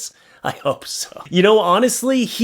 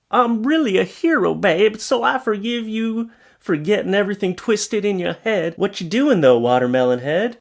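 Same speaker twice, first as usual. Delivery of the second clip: cheater playing the hero; 6 semitones higher than usual. Cheating bro claims heroism to babe, mock-earnest. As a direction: deliver his absurd excuse with raised pitch.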